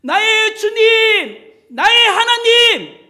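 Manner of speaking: fervent cry. Acted. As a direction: cry it out with full conviction. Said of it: "확신에 찬 믿음의 고백으로".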